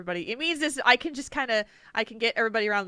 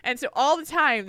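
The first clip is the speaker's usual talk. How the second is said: ordinary talk, nothing marked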